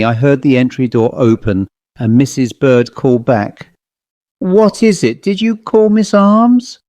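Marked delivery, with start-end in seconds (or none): 4.4-6.8 calling up. Acted. Landlady calls up from below.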